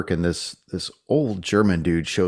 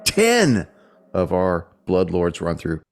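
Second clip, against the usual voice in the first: showy announcer welcome; podcast host intro. Acted. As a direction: greet listeners like a show host.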